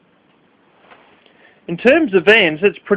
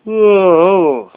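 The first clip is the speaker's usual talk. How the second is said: yawning; 5 semitones higher, 5 dB louder than usual. Real, not acted. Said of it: "That's why I'm yawning so much".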